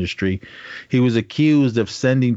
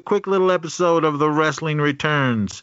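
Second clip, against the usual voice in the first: ringmaster; 7 semitones higher than usual. Pretend. Podcast segment intro announcement.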